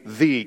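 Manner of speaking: "the" said long, as thee; stress on THE. Shown as a demonstration. Preacher marking a title, weighty drawn-out "the". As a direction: draw out "the" as a word with a capital T. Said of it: "capital T".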